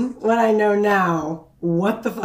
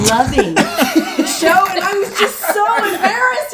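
laughing through embarrassment; 12 semitones higher, 4 dB louder than usual. Real, not acted. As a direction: giggle while confessing. Recalls childhood embarrassment, laughing.

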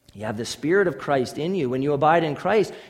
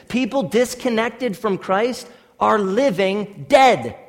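louder pulpit declaration; forceful preaching; 4 semitones higher, 6 dB louder than usual. Acted. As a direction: drive the scripture home to the pews.

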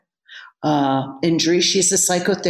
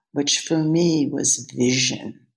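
usual speaking voice

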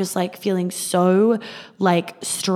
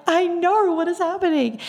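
much higher, bewildered I know; exasperated disbelief; 11 semitones higher than usual. Retold or imitated. Telling her friend she knows and asking what is happening, high and bewildered. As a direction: squeal it in mock panic. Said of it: "I'm like".

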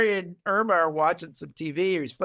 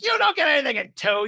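higher-pitched imitated protest; acted-out bickering, pitched up; mock scornful retort; 8 semitones higher, faster than usual. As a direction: throw it back as an indignant retort.

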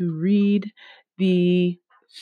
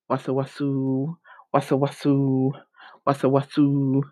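slithering sound; 3 semitones lower than usual. A narrator's reading.

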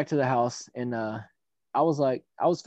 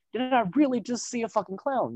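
higher, incredulous double-take voice; mock disbelief; 8 semitones higher than usual. Driver staring at a masked man, mock disbelief. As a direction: play a startled driver doubting his eyes.

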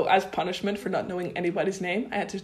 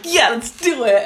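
pumped up; 5 semitones higher, 11 dB louder than usual. Retold or imitated. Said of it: "have moments where I'm like".

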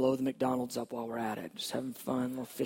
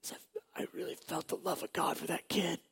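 usual speaking voice

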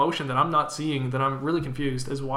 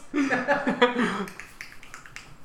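tongue click; 8 semitones higher than usual. Shown as a demonstration. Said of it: "Wow, that was really good".

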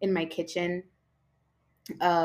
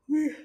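yawning; 8 semitones higher than usual. Real, not acted. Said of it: "sorry, I keep yawning too".

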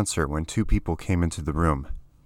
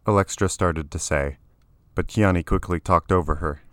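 usual speaking voice

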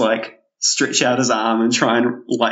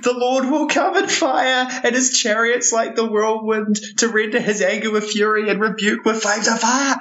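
failed angry preacher; 11 semitones higher than usual. Acted. Ten-year-old failing to sound like an angry preacher, high and solemn. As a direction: chant it sternly in a small child's voice. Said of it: "trying his hardest to sound like an angry".

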